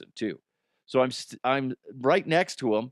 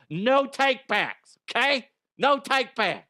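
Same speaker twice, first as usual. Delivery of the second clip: gleeful no takebacks; 11 semitones higher than usual. Pretend. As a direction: rub it in like a kid. Gleeful taunt at France.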